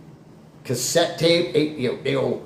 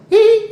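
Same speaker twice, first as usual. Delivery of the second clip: sudden loud, drawn-out "hee"; accidental-sounding squeaky noise; odd high squeal; 9 dB louder than usual. Real, not acted. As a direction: let out a silly high hee. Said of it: "I just made that sound".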